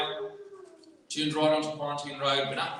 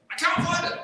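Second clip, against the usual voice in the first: higher, louder mock whine of the brother; mimicking brother; 14 semitones higher, 6 dB louder than usual. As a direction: parody his helpless excuse. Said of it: "he says".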